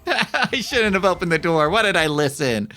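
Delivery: high, loud panicked wail; panicked regret. Real, not acted. Streamer panicking at the door he opened, high and loud. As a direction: cry out in fear of being chased.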